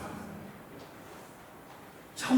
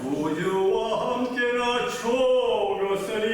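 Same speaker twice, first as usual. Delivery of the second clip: very slow, intoned recitation; solemn hymn line; much slower than usual. Acted. (찬송가처럼 천천히 읊조리듯)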